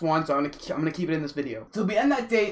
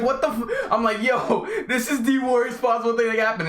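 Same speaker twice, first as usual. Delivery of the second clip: alarmed; 6 semitones higher, 3 dB louder than usual. Retold or imitated. Car hitting bumps.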